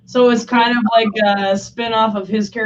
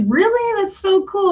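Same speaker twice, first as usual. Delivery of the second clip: much higher, gushing mock wonder; mock amazed; 10 semitones higher than usual. Friend retelling a movie scene, mock amazed and high. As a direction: play it wide-eyed and impressed. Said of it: "And it's like".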